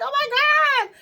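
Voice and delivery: high, distressed "oh my God"; mock despair; overwhelmed. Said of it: "I was like".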